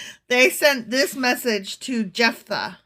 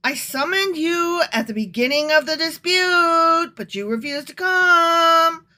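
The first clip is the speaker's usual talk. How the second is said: loud, higher, drawn-out mock exasperation; exasperated; 6 semitones higher, 4 dB louder than usual. Jephthah answering Ephraim's accusation, hammed-up exasperation. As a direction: whine each phrase upward, hammed-up and fed up. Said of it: "he's sounding so exasperated".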